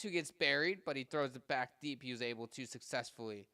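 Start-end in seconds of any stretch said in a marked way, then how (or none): none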